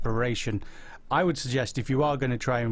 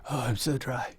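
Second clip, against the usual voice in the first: parched, dry-throated voice; dry throat, played up for chat. Real, not acted. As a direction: let them hear how dry your throat is. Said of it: "Listen to my voice".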